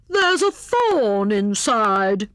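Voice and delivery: slow, drawn-out hare complaint; pained cry. A narrator's reading. Hare limping from kicking the snowball, pained cry. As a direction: wail it after hurting a foot. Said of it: "he cried, limping".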